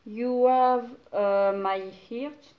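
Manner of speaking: slow, careful English read in a strong French accent. Real, not acted. Reading an English slogan to viewers, halting French-accented English. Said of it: "Je suis nulle en anglais".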